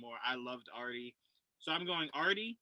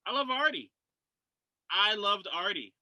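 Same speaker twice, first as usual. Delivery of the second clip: excited; 6 semitones higher, 7 dB louder than usual. Real, not acted. Gushing about favourite character.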